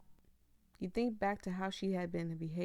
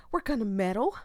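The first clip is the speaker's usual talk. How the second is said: determined; 3 semitones higher, 10 dB louder than usual. Real, not acted. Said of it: "Oh, but".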